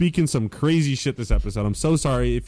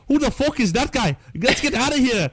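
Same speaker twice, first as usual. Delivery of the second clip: high-pitched, badly faked Irish accent; terrible Irish accent; 9 semitones higher than usual. Host attempts an Irish character for co-hosts, terrible Irish accent. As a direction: put on a bad, high Irish brogue. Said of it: "That's a terrible accent".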